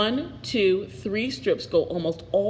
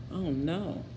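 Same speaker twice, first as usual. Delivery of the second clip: much softer worried oh no; hushed aside; 3 semitones lower, 7 dB quieter than usual. Real, not acted. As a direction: let it fall in quiet dismay. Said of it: "narrator aside".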